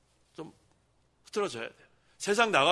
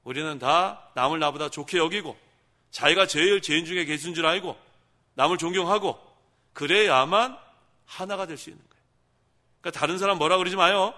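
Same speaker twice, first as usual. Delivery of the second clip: loud, driving exhortation; preaching at full force; 6 dB louder than usual. Acted. Pastor urging the congregation to humility, loud and driving. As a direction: push the congregation to act.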